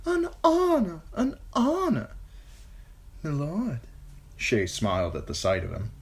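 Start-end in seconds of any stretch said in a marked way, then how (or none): 0.1-2.1 high gushing singer's voice; fawning singer. A narrator's reading. 3.2-3.8 put-on higher young woman's voice; lightly voiced young woman. A narrator's reading.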